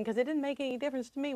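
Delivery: ordinary delivery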